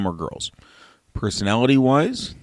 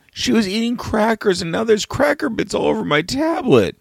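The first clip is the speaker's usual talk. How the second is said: imitating his upset daughter; 12 semitones higher than usual. Father voices his daughter's complaint about her sister's sneeze. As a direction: whine it like a wronged six-year-old.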